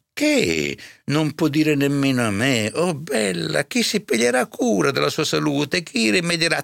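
high, insistent housekeeper; serva incalzante. A narrator's reading. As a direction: press him in a high, indignant voice. Serva incalza il curato che tace. (serva che incalza, con voce acuta)

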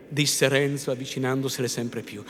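usual speaking voice

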